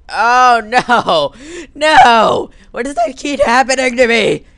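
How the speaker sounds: loud, high anguished shouting; mock despair yell. Player bemoaning yet another death, loud mock despair. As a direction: wail it loudly in hammed-up despair.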